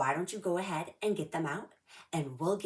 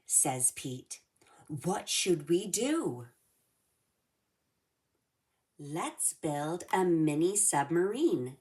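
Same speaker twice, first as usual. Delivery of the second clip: lively read-aloud storybook voice; reading to children. A narrator's reading. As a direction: read it like storytime for little kids. Reads picture book to kids.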